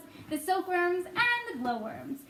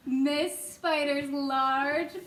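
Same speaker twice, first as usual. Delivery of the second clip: ordinary delivery, nothing marked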